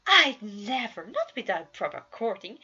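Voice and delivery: scandalized protest. A narrator's reading. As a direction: gasp it out, deeply offended.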